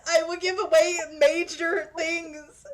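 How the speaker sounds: high, loud exasperated outburst; emphatic protest. Real, not acted. Refusing to spoil the book for a friend, emphatic loud protest. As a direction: burst out loud and high, stretching the words.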